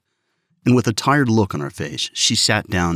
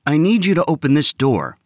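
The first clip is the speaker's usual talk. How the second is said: put-on bold boy, higher and louder; bold, a bit higher; 8 semitones higher, 4 dB louder than usual. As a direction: stand firm and say it boldly. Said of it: "Alvin said boldly".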